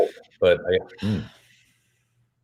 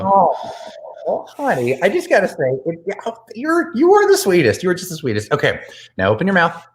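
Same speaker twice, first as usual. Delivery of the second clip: high, gushing flirty tone; 8 semitones higher than usual. Acted. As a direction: gush over them sweetly. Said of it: "your flirty voice".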